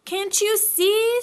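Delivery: loud high slow shout. A narrator's reading. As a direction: yell in frustration. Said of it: "shouted Piglet".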